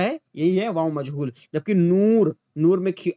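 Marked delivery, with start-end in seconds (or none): 1.7-2.4 नूर with a long drawn "oo"; teacher drawing out नूर; long maroof vowel. Shown as a demonstration. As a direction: stretch the "oo" in नूर, louder. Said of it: "बहुत ज्यादा वाव को खींचकर".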